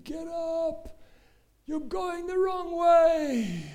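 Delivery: high, drawn-out urgent yell; crowd screaming. Retold or imitated. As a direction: call out desperately to a runner far off. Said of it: "Everyone screaming".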